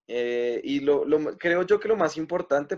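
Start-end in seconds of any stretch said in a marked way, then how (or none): none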